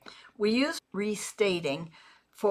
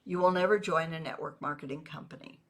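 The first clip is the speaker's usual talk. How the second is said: flat even tone, no rise at the end; just say it back evenly; calm, level, "company" kept down; neutral restating; 3 semitones lower, 4 dB quieter, faster than usual. Shown as a demonstration. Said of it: "You hear the difference".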